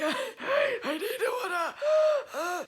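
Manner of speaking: high crying plea for water, hammed up; parched woman. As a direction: wail it like you're dying of thirst.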